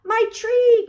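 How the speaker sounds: high, loud happy cry; high, loud glad shout; joyful cry. A narrator's reading. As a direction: shout with delight at finding it. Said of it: "cried Franklin".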